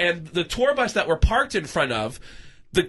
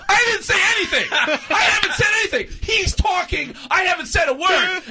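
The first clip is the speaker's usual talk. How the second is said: high, frantic mock shouting; panicked denial; 11 semitones higher than usual. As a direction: protest innocence in a hammed-up yelp. Panicked denial in retold quarrel.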